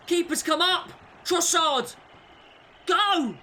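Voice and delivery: high, loud rising excitement; excited shout. Real, not acted. Gamer urging his striker through on goal. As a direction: urge him on as he breaks. Breakaway chance.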